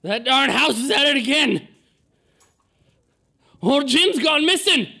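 high, loud, panicked holler; frantic old-timer yelling; screaming old man. Acted. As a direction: scream it like an elderly villager raising the alarm. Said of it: "you overhear him screaming".

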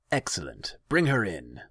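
cold command; commanding Khan. A narrator's reading. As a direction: order her brought in.

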